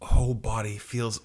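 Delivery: faint, halting inner voice; wounded, in pain. Acted. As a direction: struggle the words out with pauses. Wounded Cyclops thinking.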